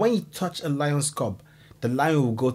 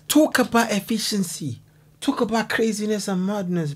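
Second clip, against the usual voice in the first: amazed; 8 semitones higher than usual. Real, not acted. Reacting to the raid.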